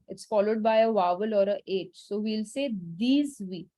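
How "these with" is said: "these with" with the S voiced as Z; French liaison, Z joining "these with"; liaison pronunciation. Shown as a demonstration. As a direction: link "these with" through a Z sound. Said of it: "the S will do a Z sound".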